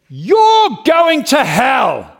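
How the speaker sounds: loud, high, drawn-out street-preacher cry; shouted street-corner condemnation; condemning shout. Retold or imitated. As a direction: bellow the verdict at the crowd, stretching it out.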